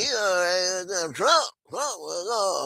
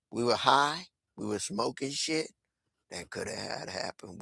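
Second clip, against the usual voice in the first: ordinary talk, nothing marked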